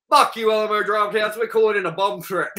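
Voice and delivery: hammed-up rowdy comeback; mock defiance. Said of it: "Me and you are just like".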